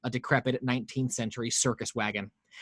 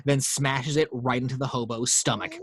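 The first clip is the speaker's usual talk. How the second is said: ordinary delivery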